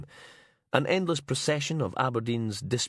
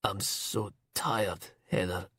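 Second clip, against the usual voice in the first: weak and tearful; 3 dB quieter, slower than usual. Acted. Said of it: "weeping quietly".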